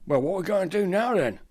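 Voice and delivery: quick, high robber question; rough robber. Acted. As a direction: blurt it out fast and high. Robber asks what now.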